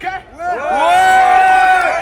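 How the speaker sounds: loud crowd cheer on cue; big cheer. Real, not acted. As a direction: yell it out as loud as you can. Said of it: "et vous me foutez le bordel".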